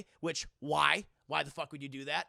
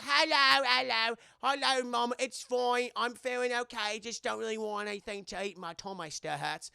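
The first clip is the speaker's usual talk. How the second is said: imitating a young girl, loud then easing off; little girl on phone; 6 semitones higher, 5 dB louder than usual. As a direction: answer mom like a poorly child. Sick British girl on the phone.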